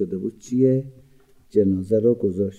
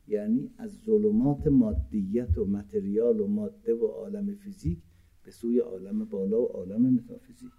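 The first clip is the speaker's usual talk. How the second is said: usual speaking voice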